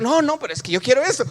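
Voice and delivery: high, loud, fast protest; mock stubborn insistence. Acted quarrel with God. (insistencia terca, aguda y rápida)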